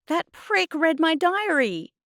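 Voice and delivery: high, loud, slow indignation; outraged complaint. A narrator's reading. Girl telling Mum about Adnan, outraged. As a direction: tell on him in a fury.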